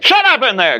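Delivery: sudden high-pitched cross yell; irritated outburst. Acted. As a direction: snap at the noisy room next door. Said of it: "just a little irritated".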